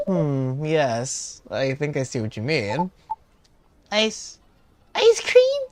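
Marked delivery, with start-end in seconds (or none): 4.9-5.6 put-on excited high-pitched child; loud, very high nine-year-old; kid voice. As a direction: squeal it loudly like a little kid. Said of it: "I'm reading in this kid voice".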